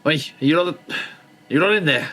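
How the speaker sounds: calling out. Acted. Player character calls through doorway.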